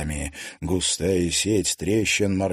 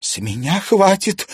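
exasperated outburst; 12 semitones higher, 7 dB louder than usual. Acted. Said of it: "выдохнула Алена Александровна".

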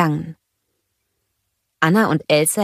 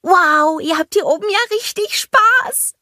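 cheery greeting; 4 dB louder than usual. A narrator's reading. Olaf greeting the snowgies, high and happy. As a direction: call out warmly to the little ones.

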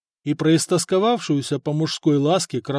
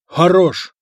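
shouted halt; 4 semitones higher, 9 dB louder than usual. Acted. Blacksmith calls halt at the forge.

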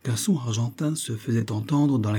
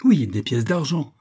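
higher, louder eager insistence; insistent, lively retort; extreme vivacity; 5 semitones higher, 5 dB louder than usual. A narrator's reading. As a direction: push it out briskly and forcefully. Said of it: "reprit le professeur avec une extrême vivacité".

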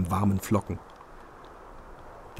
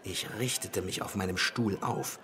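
usual speaking voice

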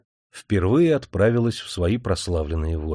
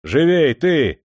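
master snapping at the coachman, higher, louder and slow; barked order; 6 semitones higher, 6 dB louder, slower than usual. Acted. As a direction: hurry the driver roughly. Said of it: "набросился хозяин на кучера". (хозяин грубо подгоняет кучера)

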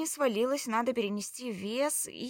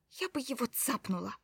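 usual speaking voice

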